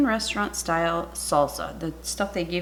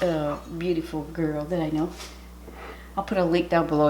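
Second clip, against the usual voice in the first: usual speaking voice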